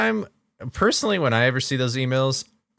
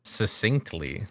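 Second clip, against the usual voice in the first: slow, quieter, relishing each syllable of "Succinctly"; drawn-out mock savouring; 6 semitones lower, 5 dB quieter, much slower than usual. Teasing a friend about his fancy word, slow and relishing.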